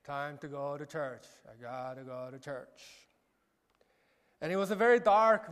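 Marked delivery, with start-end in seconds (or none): 0.0-3.1 weary drone. Retold or imitated. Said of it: "And that was me".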